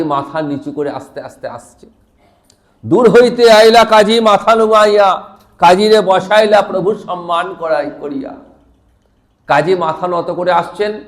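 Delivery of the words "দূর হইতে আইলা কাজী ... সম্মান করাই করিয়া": loud chanted verse, easing towards the end; verse recitation. Real, not acted. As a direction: declaim the sacred couplet in a raised voice.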